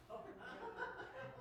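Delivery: short airy laugh; breathy laugh. Real, not acted. Laugh at the news.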